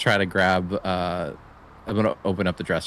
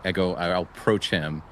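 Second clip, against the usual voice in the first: ordinary talk, nothing marked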